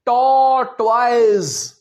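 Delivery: टॉर्टोइज loud and slow as "tor-toiz"; schoolroom tortoise. Shown as a demonstration. Teacher mimicking how schools teach tortoise. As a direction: drawl टॉर्टोइज like a class chant. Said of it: "ये है गलत".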